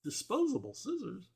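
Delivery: high-pitched puzzled query, imitating a baffled listener; incredulous crew member. Film crew questioning a nonsensical line, in mock disbelief. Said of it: "how no one on set went".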